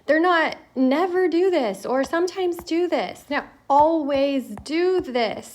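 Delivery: firm, insistent teacher emphasis; emphatic. Real, not acted. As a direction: hammer home always do this. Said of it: "I don't know how to say it more emphatically".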